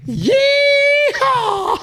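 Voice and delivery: high shouted yeehaw, cowboy style. Retold or imitated. Reading the nephew's text, a shouted cowboy yeehaw. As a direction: whoop it like a rodeo cowboy.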